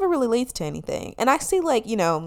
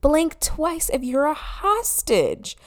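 incredulous sass; 5 semitones higher, much slower than usual. Retold or imitated. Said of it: "And I'm like".

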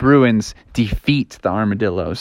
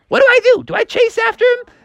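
very high falsetto worry; hammed-up parental panic, high-pitched; panicky parent; faster than usual. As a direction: fret in a squeaky high voice.

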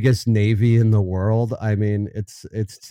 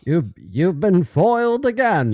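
foiled villain; 8 semitones higher than usual. Pretend. Cartoon villain catchphrase.

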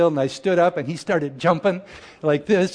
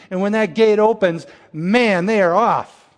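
louder, higher, racing energy; excited burst; 5 semitones higher, 6 dB louder than usual. Real, not acted. Preacher describing eager crowds at the altar, excitedly. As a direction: let it burst out like horses leaving the gate.

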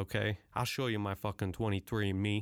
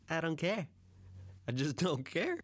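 higher, carefree I-don't-care; shrugging carelessly; 7 semitones higher than usual. Real, not acted. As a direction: toss it off with a big shrug. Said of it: "they're shrugged up".

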